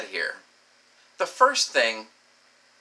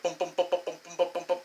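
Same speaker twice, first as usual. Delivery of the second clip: quick pitched-up mouth-made booms; imitated bumping; 5 semitones higher, 4 dB quieter, much faster than usual. Gamer showing a ship banging asteroids, imitated bumping. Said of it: "bang your ship, like".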